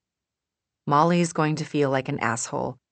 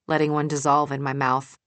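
usual speaking voice

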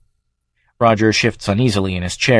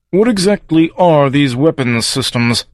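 slow, higher-pitched demand; 4 semitones higher than usual. A narrator's reading. Third inquisitor questions the witness, demanding. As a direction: draw the question out insistently. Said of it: "demanded the third inquisitor".

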